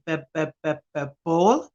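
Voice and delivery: repeated b sounds, then ball; sounding out ball. Shown as a demonstration. Nanny sounding out ball for a toddler, b b b. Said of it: "I would say".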